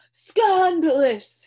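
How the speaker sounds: slow, loud, outraged royal shout. A narrator's reading. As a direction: bellow it in outrage. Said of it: "shouted the king".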